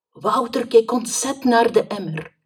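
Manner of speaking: ordinary delivery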